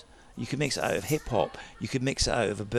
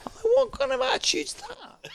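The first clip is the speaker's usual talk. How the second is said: laughing. Real, not acted. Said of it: "mock outrage, laughing".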